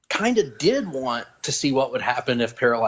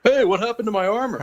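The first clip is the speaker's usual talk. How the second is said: Guy Gardner voice; 9 semitones higher than usual. Retold or imitated. Voicing Guy Gardner's complaint.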